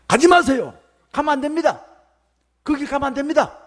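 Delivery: Peter begging Jesus not to go, high and urgent; urgent high plea, holding him back; pleading to stop him. Acted. Peter blocking Jesus' path, pleading urgently. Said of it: "라고 베드로가 가로막았습니다".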